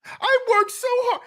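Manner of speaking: very high mock wail; hammed-up, high-pitched aggrieved whine; whining mama-like complaint. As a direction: mimic a man complaining like his mother. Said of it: "You sound like your mama".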